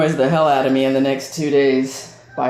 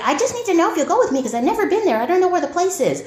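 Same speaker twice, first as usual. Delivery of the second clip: higher, fast imitation of mom; quoting mom; 13 semitones higher, faster than usual. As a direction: mimic mom hurriedly pleading. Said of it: "So she's like".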